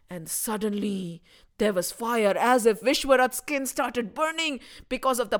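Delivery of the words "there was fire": plain reading voice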